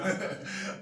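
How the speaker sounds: short breathy laugh; quick unvoiced chuckle. Real, not acted. Laughing at own story.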